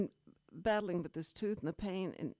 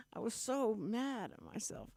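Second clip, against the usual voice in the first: ordinary talk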